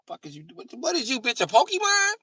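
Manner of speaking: rising high incredulous yell; outraged yell. Acted. Comedian mocking the hot shower, building to a yell.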